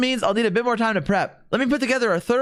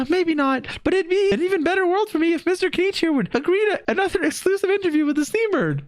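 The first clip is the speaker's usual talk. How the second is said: higher, chirpy journalist voice; high reporter voice; 11 semitones higher than usual. Acted. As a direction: pitch up like a pushy reporter. Reads Charlotte's lines aloud.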